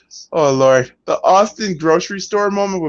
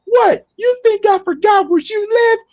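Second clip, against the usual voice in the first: much higher, hammed-up taunting threat; mock menacing threat; 13 semitones higher than usual. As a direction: sneer it at a cowering manager. Undertaker voiced threatening Paul Bearer.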